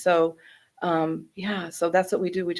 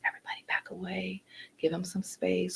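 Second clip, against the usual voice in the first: hushed, whispery crowd control; quiet library voice; 10 dB quieter than usual. Retold or imitated. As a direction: imitate clearing people back in a soft whisper. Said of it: "quiet library voices".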